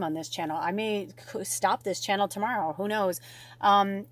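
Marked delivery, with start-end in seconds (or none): none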